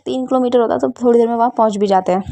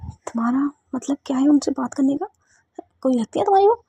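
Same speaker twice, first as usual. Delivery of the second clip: angry whispered hiss; jealous whisper; 3 semitones higher than usual. Acted. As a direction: hiss it so only she hears. Siya hisses jealously at Ruhi.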